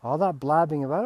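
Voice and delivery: ordinary talk, nothing marked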